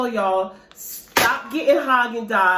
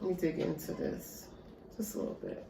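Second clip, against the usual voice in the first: whisper; 3 semitones lower, 15 dB quieter, faster than usual. Real, not acted. Whispering while eating.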